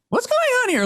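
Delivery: high-pitched baffled question; mock bewilderment. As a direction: act lightly thrown, voice jumping up. Acting out the public's confusion.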